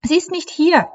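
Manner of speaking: hohe, verzweifelte Mädchenstimme; aufgelöste Meerjungfrau. A narrator's reading. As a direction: junge Meerjungfrau, den Tränen nahe, sprich hoch. Leilani's high mermaid voice. (hohe Stimme einer verzweifelten jungen Meerjungfrau)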